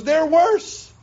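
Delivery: very high, incredulous squeak of protest; comically aghast prophet, rising sharply; Habakkuk. Acted. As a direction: shoot the voice way up in disbelief. Habakkuk objects in disbelief.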